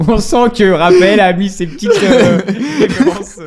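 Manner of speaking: high, loud, laughing remark; amused, laughing. Real, not acted. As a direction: crack up over the words. Cracks up at the clue.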